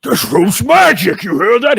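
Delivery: loud, amazed tavern oaf; Eglith. Acted. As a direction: bellow the discovery across the room at the bartender. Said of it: "He looks at the bartender while saying it".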